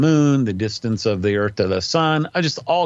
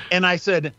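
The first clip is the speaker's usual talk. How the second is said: usual speaking voice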